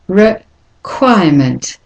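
"Requirement" slowed, with a gap after re; slow split model, re... quirement; syllable-by-syllable demonstration. Shown as a demonstration. Teacher breaks word into syllables.

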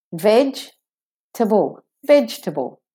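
smooth "Vegetable" without the middle e; three-syllable vegetable. Shown as a demonstration. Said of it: "sounds staccato".

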